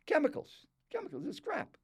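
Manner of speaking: imitated indignant food critic; mock disgusted outburst. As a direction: toss each verdict out high and scornful.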